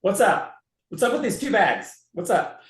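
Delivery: cheeky heckle of a passenger; teasing challenge. Retold or imitated. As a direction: stop them with a playful challenge. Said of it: "and I go like this".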